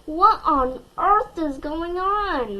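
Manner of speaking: a mother losing patience; exasperated mom's cry; dismayed cry. A narrator's reading. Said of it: "my mom cried".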